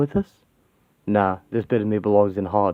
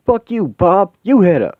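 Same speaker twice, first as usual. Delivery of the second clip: loud, higher furious yell at Bob; angry outburst; 7 semitones higher, 7 dB louder than usual. Acted. Yelling at Bob for hitting her, furious. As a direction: shout him down for hitting her.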